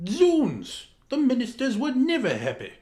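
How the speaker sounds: a sudden high, loud cry of exasperation; exasperated outburst. A narrator's reading. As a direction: burst out as if throwing up your hands.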